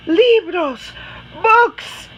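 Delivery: high, loud excited gasp then Libros, Books. Acted. Children see the books.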